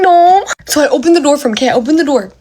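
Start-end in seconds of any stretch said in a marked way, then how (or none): 0.0-0.5 high, loud wailed no; distressed. Retold or imitated. Scared bather answering "are you okay", loud wail. Retold frightened answer to knock.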